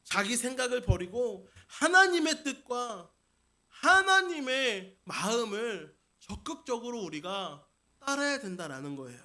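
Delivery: ordinary talk, nothing marked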